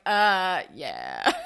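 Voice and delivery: hesitant yeah dropping very low; awkward admission. Retold or imitated. Dave asked if they are a thing, awkwardly admitting it. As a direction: imitate a reluctant, sheepish confirmation.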